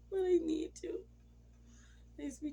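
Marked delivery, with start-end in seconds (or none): 0.1-1.1 higher tearful plea. Retold or imitated. Pleading with late husband.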